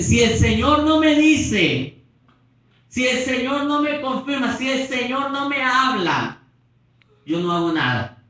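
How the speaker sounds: mocking whiny excuse. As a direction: drag out the excuse like a stubborn doubter.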